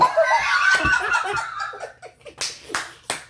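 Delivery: long, high, breathless burst of laughter; real laughing fit. Real, not acted. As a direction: crack up at your own punch line. Laughs at own punch line.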